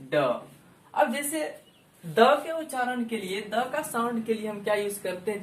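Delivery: ordinary talk, nothing marked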